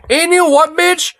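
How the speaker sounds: high, pointed call-out; sharp high taunt at the commentator; confrontational jab. Real, not acted. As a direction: square up and call her out. Addresses Loomer directly.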